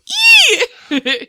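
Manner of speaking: high-pitched fangirl squeal; excited squeal. Retold or imitated. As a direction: squeal with delight. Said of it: "How many times did you go".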